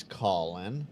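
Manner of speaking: ordinary delivery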